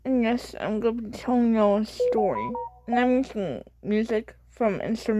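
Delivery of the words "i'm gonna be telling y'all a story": ordinary delivery, nothing marked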